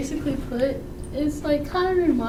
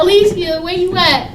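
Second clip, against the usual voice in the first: calling out dad; 3 semitones higher, 7 dB louder than usual. Retold or imitated. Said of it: "My dad would be like this".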